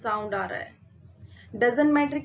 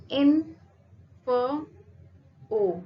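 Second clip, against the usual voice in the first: इन, फ, ओ each said alone with a pause after it; syllable by syllable; 3 dB louder, much slower than usual. Shown as a demonstration. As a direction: break it into three separate beats, इन - फ - ओ. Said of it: "यहाँ पे आपके तीन syllable है".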